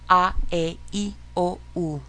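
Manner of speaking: "A, E, I, O, U" as ah, eh, ee, oh, oo; Spanish vowel sounds. Shown as a demonstration. Teacher leading a practice drill, "A, E, I, O, U" as Spanish vowels. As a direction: chant "A, E, I, O, U" in separate pure Spanish vowels. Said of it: "actual sounds of the vowels in the Spanish".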